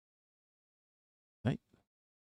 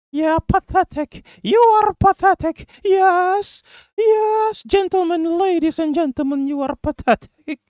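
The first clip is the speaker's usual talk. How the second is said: hammed-up, very high British caricature; mock British accent. Streamer mocking the accent of a disliked commentator, mock British. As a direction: squeal it out as an absurd British parody. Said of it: "He does have a British accent".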